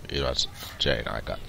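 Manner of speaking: ordinary delivery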